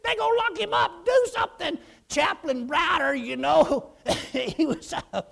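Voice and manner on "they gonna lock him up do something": high, pleading urgency; retold panicked plea; urgent panic. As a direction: beg for quick action. Urging chaplain in panic.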